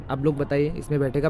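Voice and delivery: ordinary talk, nothing marked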